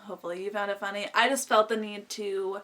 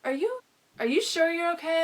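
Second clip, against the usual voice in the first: higher-pitched worried check-in; imitated concern; 7 semitones higher than usual. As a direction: lightly mimic a concerned friend, pitched up. Friend checks whether she is okay.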